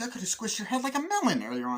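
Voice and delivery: high, quick impression of a film actor; high-pitched, lightly exasperated quote. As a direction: pitch it right up and rush it. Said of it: "Steve Martin's voice is all high-pitched".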